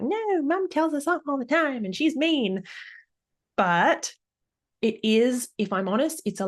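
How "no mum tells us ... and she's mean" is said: put-on high childish whine; mock kid telling on mum; complaining kid. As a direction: tattle like a sulky child. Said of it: "they'll be like".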